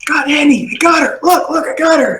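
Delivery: high, frantic boast from a guard; excited guard. Acted. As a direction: call out your catch in a high voice. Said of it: "a guard you knew going".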